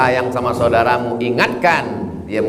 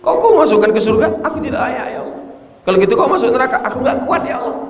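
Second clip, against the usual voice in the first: higher, pleading voice of a sinner; 11 semitones higher than usual. Acted. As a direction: beg God in a higher voice. Preacher voices sinner pleading with God.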